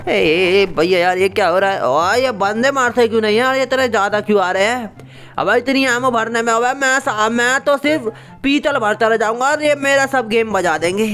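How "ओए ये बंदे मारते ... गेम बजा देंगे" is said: higher, fast frantic complaining; flustered gamer bro. Acted. Swaggering buddy complaining the enemies keep coming, flustered. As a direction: panic over the endless reloading. Bro panicking over reload.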